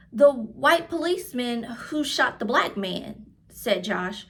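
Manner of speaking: clear, lively read-aloud voice for a class; reading to children. A narrator's reading. Teacher reading a picture book aloud to her class, lively storytelling. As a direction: tell the story warmly to young listeners.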